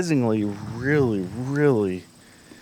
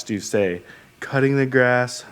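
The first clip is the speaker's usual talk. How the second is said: ordinary talk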